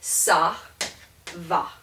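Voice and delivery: SA ... VA, two separate syllables; sounded out. Shown as a demonstration. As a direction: break "Ça va" into SAH, pause, VAH. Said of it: "spelled the way it sounds".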